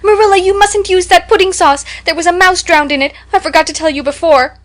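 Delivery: high, loud shriek of alarm. A narrator's reading. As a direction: shriek it out in horror. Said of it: "shrieked out".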